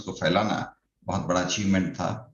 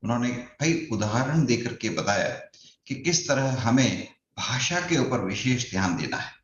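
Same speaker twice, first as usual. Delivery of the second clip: ordinary talk, nothing marked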